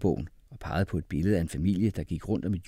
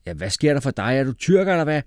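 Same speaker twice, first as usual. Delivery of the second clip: loud, high jeering question; raised, challenging voice; shouted; 7 semitones higher, 7 dB louder than usual. A narrator's reading. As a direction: yell it back at a classmate. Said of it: "råbte Umfufu".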